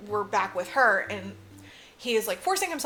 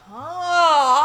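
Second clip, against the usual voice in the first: huge, loud, drawn-out mock gasp; exaggerated, higher-pitched astonishment; acted bafflement; 6 semitones higher, 12 dB louder than usual. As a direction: blow the sound up into comic confusion. Acting out confused first-time viewer.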